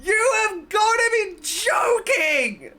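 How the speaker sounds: high, slow exasperated yelling; you can't believe how bad this is. Real, not acted. Ranting at bad jump scare.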